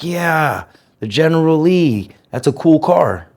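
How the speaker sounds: put-on reliving a kid's wide-open enthusiasm, pitched well up; naive delight. Newcomer admiring the Dukes of Hazzard car, naively delighted.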